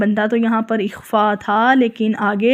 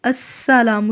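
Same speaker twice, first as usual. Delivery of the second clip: normal talking voice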